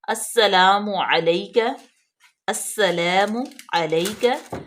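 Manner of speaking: slow "السلام علیکہ" with the doubled سین hissed; slow recitation; careful recitation. Shown as a demonstration. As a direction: model "السلام علیکہ" slowly. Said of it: "سین جو ہے وہ مشدد ہے".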